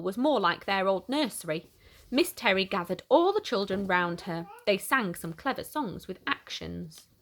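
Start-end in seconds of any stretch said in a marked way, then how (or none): none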